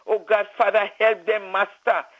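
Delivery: ordinary talk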